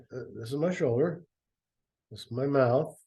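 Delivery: ordinary delivery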